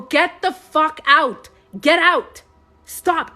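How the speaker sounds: re-enacted high, loud shouts; retold screaming. Retelling how she ordered her sadness out, screaming. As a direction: shout it forcefully, ordering it gone. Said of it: "I was screaming at my".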